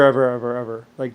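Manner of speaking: ordinary delivery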